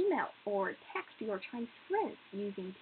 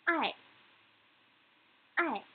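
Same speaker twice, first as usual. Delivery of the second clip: "ai" falling straight down; fourth tone; 4 semitones higher than usual. Shown as a demonstration. Teacher pronounces the word for love, "ai" in falling fourth tone. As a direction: drop the pitch sharply through "ai". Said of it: "is the fourth tone".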